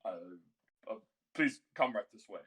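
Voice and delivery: a hint of a hesitant old attendant; old man servant. Old servant leads guests up to their rooms, hesitant. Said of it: "an old man behind them".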